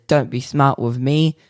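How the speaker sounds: ordinary talk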